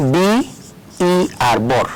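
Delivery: letters drawn out very slowly; slow spelling. Shown as a demonstration. Teacher spelling a syllable slowly for the class. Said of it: "এটা হয়ে গেল আরেকটা sound unit".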